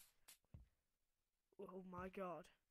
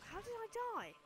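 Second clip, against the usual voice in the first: high-pitched, louder repeat; squeaky disbelief; 13 semitones higher, 9 dB louder than usual. Real, not acted.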